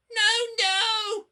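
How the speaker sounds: very high, loud terrified wail. A narrator's reading. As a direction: cry out in panic. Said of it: "wailed the empty trucks".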